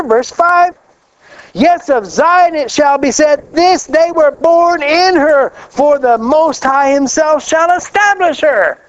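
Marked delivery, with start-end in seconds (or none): 1.5-8.8 scripture reading. Acted. Reads scripture, higher, slow.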